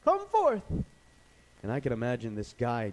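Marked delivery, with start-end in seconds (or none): 0.0-0.6 huge high commanding shout; booming yell, pitch way up. Acted. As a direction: call the dead out of the tomb. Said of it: "the Lord yelling out".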